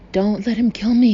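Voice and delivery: loud, high, fake-terrified whimper. A narrator's reading. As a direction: beg for your life in acted terror. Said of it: "he whimpered".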